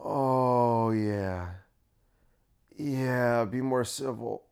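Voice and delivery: low, hushed, drawn-out moans of agreement; mock-sensual radio host purr; Kristen. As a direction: murmur slowly as if getting off on it. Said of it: "and then Kristen's like".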